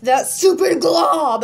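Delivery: slow, loud, exasperated snap; irritated outburst. Retold or imitated. Venting at a glob, loud and slow. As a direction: spit it out at the annoying thing. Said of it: "I'm just sitting here like".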